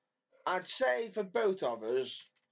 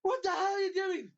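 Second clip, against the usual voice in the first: mock angry shout; 12 semitones higher than usual. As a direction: hurl the question like an outraged friend. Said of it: "He's like".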